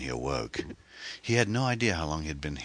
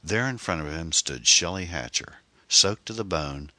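ordinary talk

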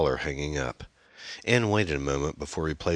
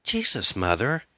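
put-on exasperated teenage girl, high and louder at first; sputtering; 8 semitones higher, 4 dB louder than usual. A narrator's reading. Daughter doubted by mother, sputtering exasperation. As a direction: burst out in indignation at Mom. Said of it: "Amy sputtered".

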